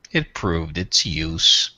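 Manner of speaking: low, slow, aloof alien lord; Tyrath voice. Acted. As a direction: speak down to them, coldly measured. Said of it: "and he says".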